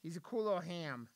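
usual speaking voice

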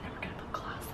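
soft whispered aside; whispering. Real, not acted. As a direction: say it under your breath. Whispered while applying.

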